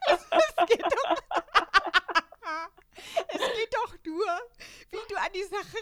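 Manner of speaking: words pushed out through high laughter; laughing while talking. Real, not acted. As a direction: try to explain but keep cracking up. Laughing through her own explanation.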